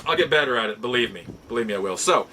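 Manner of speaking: ordinary talk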